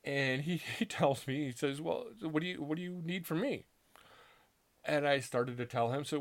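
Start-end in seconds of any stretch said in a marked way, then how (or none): 1.8-3.7 lightly voiced priest, pitched higher; a hint of a bemused priest asking; amused priest. Priest brushes off his story, a touch amused and higher. Priest answers him, laughing.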